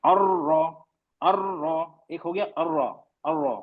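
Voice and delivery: अर्र with a firm rolled double r; trilled emphatic अर्र; stressed rr. Shown as a demonstration. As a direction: grip the र्र in अर्र hard. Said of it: "थोड़ा हमने जोर दिया, मजबूती से पकड़ा".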